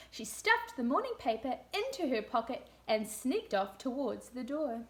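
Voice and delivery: put-on lively read-aloud for young children; storybook reading. A narrator's reading. Teacher reading a story to room two, lively storybook voice. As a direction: read it like a picture book to a class.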